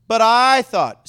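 "I" stretched loud and high, "thought" falling; loud, self-important "I", then drop on "thought"; heavy stress on "I". Shown as a demonstration. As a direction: lean long and hard on "I". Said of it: "Say it with emphasis".

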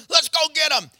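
loud eager war cry; rally the troops, shouting; battle shout. Acted. Mighty men shout as they charge into battle, loud and eager.

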